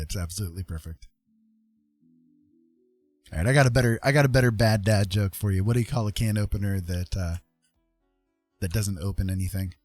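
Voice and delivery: usual speaking voice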